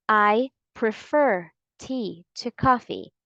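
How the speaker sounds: slow, careful reading of the sentence; slow model reading. Shown as a demonstration. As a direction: read it slowly and clearly. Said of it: "Let's have it faster".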